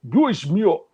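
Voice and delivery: a hammed-up Russian accent, Colossus style; mock Russian strongman exclamation. Asked to do a Russian accent, he tries Colossus. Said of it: "can you give us a decent Russian accent".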